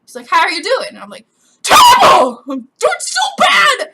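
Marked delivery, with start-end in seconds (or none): 0.3-0.9 louder, higher, upbeat question; mock cheery phone voice; chipper support agent. Imitates the chirpy support caller. 1.6-3.9 high, wailing shriek; over-the-top tearful yelling; distraught scream. Retold or imitated. Acted upset answer to the agent.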